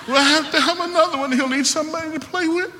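high, put-on voice of the wife; wife's voice. Wife in delivery room asking for another child, high mimicked voice.

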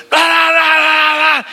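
high, loud mock babble of shouting; imitating a noisy family's racket; loud family yelling. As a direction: shout nonsense over everyone. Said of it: "they get very loud".

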